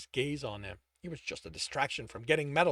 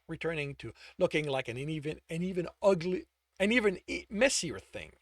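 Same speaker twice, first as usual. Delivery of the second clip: ordinary delivery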